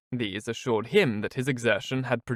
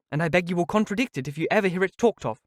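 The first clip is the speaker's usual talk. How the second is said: high, put-on pleading voice; affected earnestness; 7 semitones higher than usual. Miss Steele begs Mrs Jennings, affectedly earnest. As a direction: overdo the sincerity. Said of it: "with affected earnestness".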